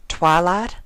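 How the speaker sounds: a hint of a drawling farm mare; lightly voiced Applejack, unsure; Applejack, hesitant. A narrator's reading.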